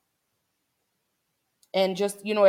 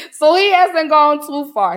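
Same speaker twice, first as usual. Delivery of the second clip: loud laugh spilling into the words; real burst of laughter, then higher loud talk; 9 semitones higher, 10 dB louder than usual. Real, not acted. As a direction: laugh through the line, tender and amused. Laughs about seeing dad's face.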